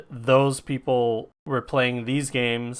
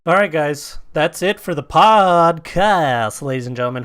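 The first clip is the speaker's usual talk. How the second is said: louder opening, stretched-out "podcast"; showy podcast sign-off; 3 semitones higher, 7 dB louder than usual. Pretend. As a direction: sign off like a show host, stretching key words. Hosts signing off the podcast.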